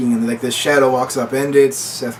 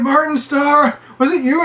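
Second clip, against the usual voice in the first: accusing; 13 semitones higher, slower than usual. Retold or imitated. Accusing Martin Starr.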